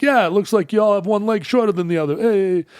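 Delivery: put-on high New York accent; New York guy. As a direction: needle him like a cocky New Yorker. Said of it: "he's like".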